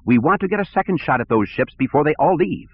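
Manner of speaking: high, impatient order; impatient director. Acted. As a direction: hurry them along peevishly. Sivron over speakers.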